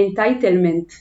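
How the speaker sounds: Entitlement in a heavy Israeli accent. Shown as a demonstration. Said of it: "אני אגיד את זה במבטא ישראלי".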